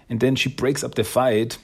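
normal talking voice

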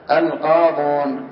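القاضون said slowly, the damma before the waw clear; slow final form. Shown as a demonstration. Teacher showing students the lightened final form, slow. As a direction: draw out القاضون gently and evenly. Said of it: "صارت القاضون".

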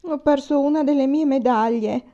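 put-on old man suddenly whining to his son about a medal; whining like a boy. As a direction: complain like a child who lost a toy. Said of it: "con una voce improvvisamente diversa ... come di ragazzo che si lagna".

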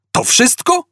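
very high, incredulous squeal; exclaimed. A narrator's reading. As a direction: shoot the pitch right up in disbelief. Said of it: "wykrzyknął Artur".

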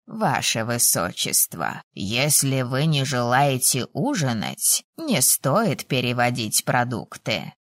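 put-on low, slow elderly reproach; reproachful old man. A narrator's reading. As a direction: chide a prince slowly in an old man's low voice. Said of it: "укоризненно заметил старичок". (укоризненно, низким старческим голосом, медленно)

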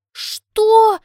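high, very loud gasped question; shocked gasp. A narrator's reading. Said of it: "ахаю я".

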